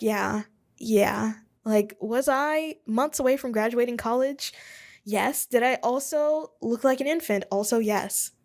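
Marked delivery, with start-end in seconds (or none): none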